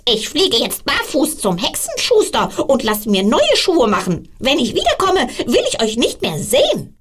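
high, strained witch voice scolding; angry witch. Acted. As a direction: snap at the shoes like a furious old witch.

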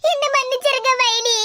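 sobbing plea for forgiveness; weeping apology; crying. Acted. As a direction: beg tearfully, trailing into sobs. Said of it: "அழாதன்னு எத்தனை நேரம் சொன்னாலும்".

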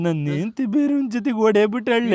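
slow, mournful whine; tearful confession. Acted. Husband telling friend his wife ran off, tearful. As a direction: confess it miserably, dragging the words.

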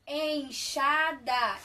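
enxada said slowly, syllable by syllable; syllable-clapping. Shown as a demonstration. Teacher clapping syllables with the class, slow and spaced. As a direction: clap out enxada slowly in three beats. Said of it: "bate palminha".

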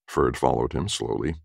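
usual speaking voice

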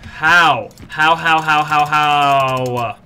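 long, drawn-out loud howl; frustrated wailing. Real, not acted. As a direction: wail the word out, stretching it in exasperation. Losing to Reaper, wailing in frustration.

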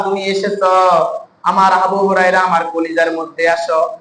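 normal talking voice

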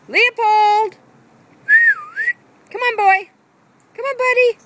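high, loud call to the dog; urging Leopold to come. Real, not acted. Calling Leopold over.

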